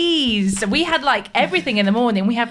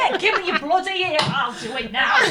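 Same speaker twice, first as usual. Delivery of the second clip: hammed-up exasperated mum, higher pitch; rage-filled mum; 9 semitones higher than usual. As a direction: imitate mum snatching the job away. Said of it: "my mum was a bit rage-filled".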